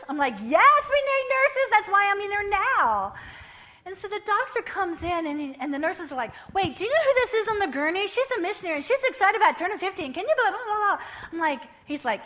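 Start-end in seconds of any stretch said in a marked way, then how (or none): 0.4-3.1 much higher, louder excited reply; thrilled high-pitched answer; excited exclaim. Retold or imitated. Retold excited reply to nurses. 6.5-11.0 high, loud, fast excited chatter; hammed-up eager nurses.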